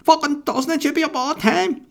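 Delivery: very high, loud put-on rant; mock outraged local. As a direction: shriek it like a furious flag fan. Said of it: "you're like".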